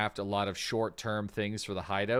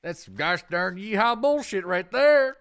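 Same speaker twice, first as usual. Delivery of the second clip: hammed-up cowboy accent; 7 semitones higher, 5 dB louder than usual. Mocking a loadout to chat in a hammed-up cowboy accent. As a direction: ham up a yeehaw cowboy accent. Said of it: "Anytime I do that accent".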